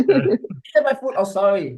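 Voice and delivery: loud, high mock complaint through laughter; laughing re-enactment. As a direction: act it out while cracking up. Acting out comic scene while laughing.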